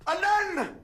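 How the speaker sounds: the moment the charade is solved; triumphant shout. Acted. Guesser finally gets the answer.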